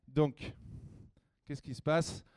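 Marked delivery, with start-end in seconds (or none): none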